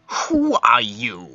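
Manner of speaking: slow, drawn-out challenge; glaring Infernal. Acted. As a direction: question the stranger slowly, with a glare. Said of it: "Infernal sort of glares at him".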